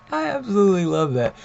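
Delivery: real amused laugh breaking in; laughing delight. Real, not acted. Reviewer laughing at Forge's line, laughing delight. Laughing while praising the comic.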